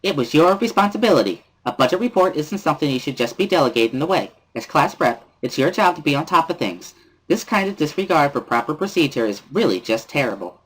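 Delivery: put-on high, bright-spirited girl pressing hard; sing-song scolding of the class rep; cheerful Misha. Misha scolding Lily about the late budget, high and chirpy.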